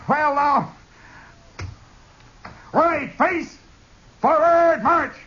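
high, strained lieutenant scolding; flustered officer. Retold or imitated. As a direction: scold the company, then bark the march order. Lieutenant scolding then commanding troops.

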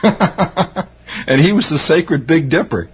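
laughing through the words, slowed; can barely get it out for giggling. Real, not acted. Radio host recalls the lodge title, laughing slowly.

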